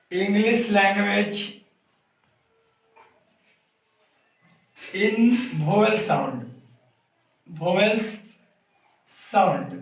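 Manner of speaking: words spaced out with long gaps; write-along pace, pausing between words; slow dictation. Real, not acted. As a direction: dictate piece by piece while writing. Dictating words while writing them out.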